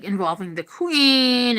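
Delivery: normal talking voice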